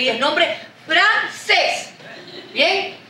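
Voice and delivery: ordinary talk, nothing marked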